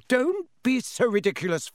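high flustered protest; mother caught out by her son, flustered; flustered denial. Acted. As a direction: brush it off, high and embarrassed. Mrs Weasley flustered, denying.

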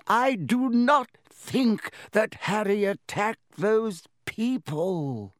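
put-on slow, high, deliberate old wizard; firm Dumbledore. A narrator's reading. Dumbledore settling Hagrid's fears, slow and firm. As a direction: lay each word down firmly.

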